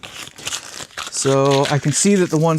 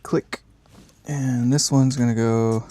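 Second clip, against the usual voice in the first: ordinary talk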